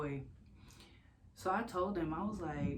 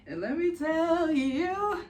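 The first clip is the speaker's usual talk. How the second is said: laughing; 13 semitones higher, 10 dB louder, slower than usual. Real, not acted. Laughing build-up.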